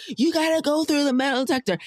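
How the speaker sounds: high, bossy imitation of the guard; mock security guard. Imitating the security guard stopping her, high and bossy. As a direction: mimic the guard ordering her through. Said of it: "This guy jumped in front of me and was like".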